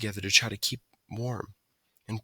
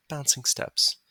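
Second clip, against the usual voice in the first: plain reading voice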